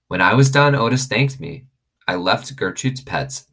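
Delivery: usual speaking voice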